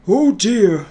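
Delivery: a hint of a fretting old Santa; worried Father Christmas. A narrator's reading. Father Christmas stuck in the snow, fretting. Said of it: "thought Father Christmas to himself".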